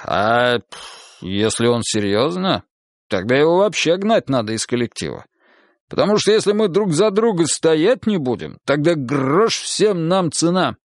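worked-up captain laying down the law; indignant police captain. A narrator's reading. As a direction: argue it with lively, indignant emphasis.

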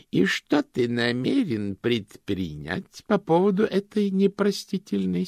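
high, slow, outraged matron; shrill indignant old woman. Acted. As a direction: voice her as a haughty old woman. Josephine's indignant demand at meeting.

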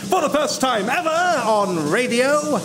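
high, drawn-out ringmaster introduction; grand showman announcement. Acted. Announcer presents a professor's spoken mime to the audience, grandly.

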